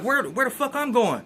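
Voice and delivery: high, startled where am I going; retold shock at being moved; surprised protest. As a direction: caught off guard, pitch jumping up. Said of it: "I'm like".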